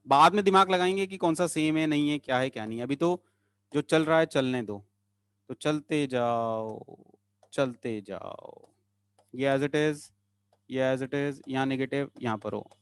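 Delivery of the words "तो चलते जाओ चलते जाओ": low, slow, soft singsong; drawn-out coaxing. Real, not acted. Teacher lulling students onward, low slow singsong. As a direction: stretch it like a lulling refrain, fading away.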